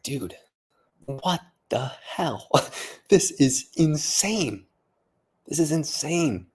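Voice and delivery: slow, drawn-out incredulous reaction; can't believe what you just heard; stunned disbelief. Retold or imitated. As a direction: stretch each word, pitch rising in amazement. Retelling own stunned reaction.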